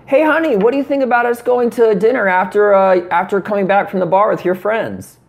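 a man playing the girlfriend, asking about dinner. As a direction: sweetly ask as the girlfriend. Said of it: "You wanna try it on a girl way".

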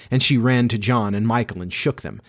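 ordinary delivery

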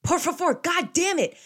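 high, loud outburst at own slip; exasperated self-correction. Real, not acted. Cursing own language mistake, loud exasperation. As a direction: burst out, annoyed at yourself.

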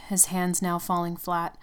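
plain reading voice

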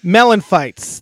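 loud slow host announcement; podcast host intro. Acted. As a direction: announce the show with gusto.

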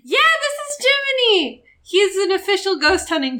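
put-on high, eager kid voice; excited. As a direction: proudly show off the dog. [name] introduces his dog.

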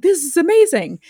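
high-pitched gushing self-quote; retold amazement. Host recalling seeing the viral story, excited amazement. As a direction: relive the delighted first reaction. Retells own delighted reaction.

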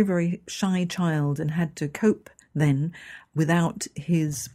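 ordinary talk

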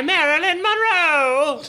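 put-on high, theatrical old queen voice; hammed-up queenly musing, pitched high; silly old queen. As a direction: camp it up as a fussy old queen. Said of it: "is clearly a silly old queen".

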